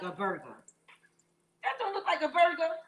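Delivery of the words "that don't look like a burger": high, emphatic repeat; excited boast. Real, not acted. Cook boasting to viewers about her burger, excited. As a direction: brag it up an octave.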